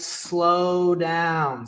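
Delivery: very slow, elongated "slow down"; drawn-out slow. Shown as a demonstration. Coach urging viewers to ease off, drawn-out slow. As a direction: stretch SLOW and DOWN out long. Acts out his advice to slow.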